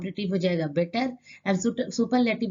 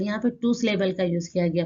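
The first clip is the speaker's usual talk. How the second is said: ordinary talk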